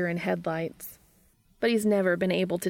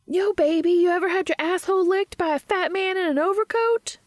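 loud, high-pitched movie-character holler; Jay; 12 semitones higher, 6 dB louder than usual. A narrator's reading. Jay on screen propositions someone in the movie, loud high holler. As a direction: belt it out way up high, brashly. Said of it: "the character of Jay says".

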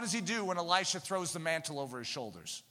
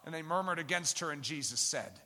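ordinary talk, nothing marked

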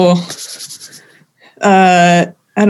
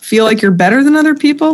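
incredulous; 7 semitones higher than usual. Retold or imitated. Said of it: "I'm like".

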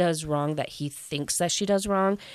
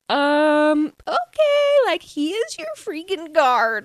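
high, loud squealing excitement; freaking out; 10 semitones higher, 5 dB louder, slower than usual. Retold or imitated. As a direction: shriek like a giddy best friend. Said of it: "She's like".

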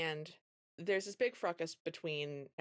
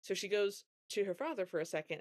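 ordinary talk

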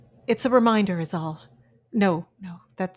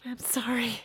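barely voiced, tearful whisper; whispered apology; 3 semitones higher, 8 dB quieter than usual. Eve apologizing to Mary in a broken whisper. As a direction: whisper it, holding back tears.

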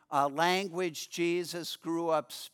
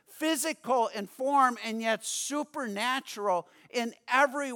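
rising preaching; 5 semitones higher than usual. Acted. Preacher voices Mary's realization.

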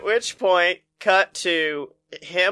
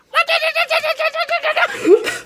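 kung fu battle cry. Retold or imitated. Liu Kang kick sound effect.

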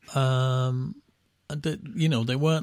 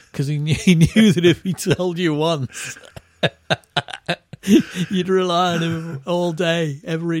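laughing; 3 semitones higher, 6 dB louder than usual. Real, not acted.

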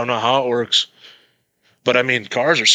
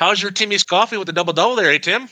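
higher pitched hammed-up Canadian accent; 8 semitones higher than usual. Joking with his buddy in a higher, hammed-up Canadian accent. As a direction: play a chirpy Canadian at Tim Hortons, voice pitched up. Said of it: "talking to a Canadian's real easy".

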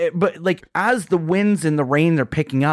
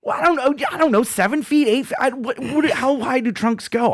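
flustered backpedaling; 11 semitones higher than usual. Real, not acted. Flustered when challenged on ten feet.

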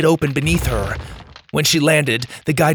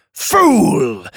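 loud, contemptuous snap; spat insult; 5 semitones higher, 6 dB louder than usual. Acted. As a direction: spit the word with scorn. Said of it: "Drake spat".

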